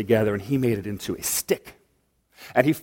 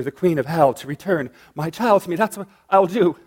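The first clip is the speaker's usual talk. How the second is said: higher, frantic pleading no; panicked grieving mother; desperate denial; 5 semitones higher than usual. Acted. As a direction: refuse to believe it. Goddess grieving over dead son.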